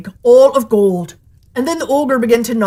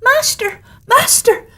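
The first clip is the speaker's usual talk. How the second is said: very high, piercing cry; a small magic harp crying for help; the golden harp calling its master in a very high voice; harp calling loudly; 4 dB louder, slower than usual. A narrator's reading. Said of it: "the harp called out quite loudly".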